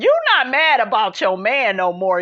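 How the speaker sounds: ordinary talk, nothing marked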